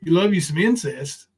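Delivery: usual speaking voice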